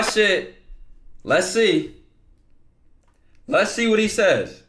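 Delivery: loud, high, repeated hype calls; hyped-up suspense. Real, not acted. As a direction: call it out big, building the suspense. Hyped reaction before the reply.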